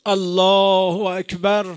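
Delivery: Jaber's cry at the grave; shouted takbir. A narrator's reading. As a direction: stretch out Allahu akbar as a cry. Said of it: "بعد فریاد زد".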